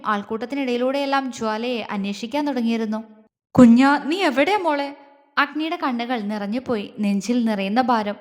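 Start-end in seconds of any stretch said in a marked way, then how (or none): 3.6-4.9 loud opening call, then pleading; anguished calling. A narrator's reading. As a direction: call out for the missing beloved. Calling out for missing girl.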